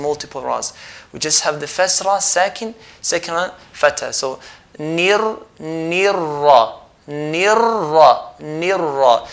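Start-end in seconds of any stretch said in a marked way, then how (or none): 4.8-9.3 very slow "nirra" with ra drawn out; rolled ra demo. Shown as a demonstration. Teacher shows the rolled ra slowly. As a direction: roll "nirra" to show the trill. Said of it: "roll the ra and produce multiple ras".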